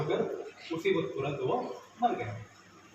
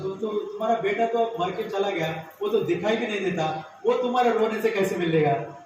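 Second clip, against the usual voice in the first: higher, loud challenging voice; challenging child; 5 semitones higher, 8 dB louder than usual. Acted. Child challenges the grieving father, loud and high. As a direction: challenge him, rising and loud.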